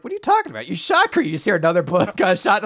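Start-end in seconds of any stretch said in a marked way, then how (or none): 0.0-1.3 much higher, incredulous accusation; mock disbelief. Second person exposing Jesse to the others, mock shocked accusation. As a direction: act shocked at the obvious lie. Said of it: "the other person goes".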